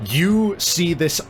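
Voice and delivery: normal talking voice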